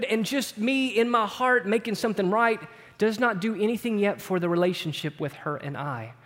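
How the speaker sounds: ordinary talk, nothing marked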